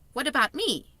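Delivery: a hint of a young girl; Sophie's voice. A narrator's reading. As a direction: read her as a polite small girl.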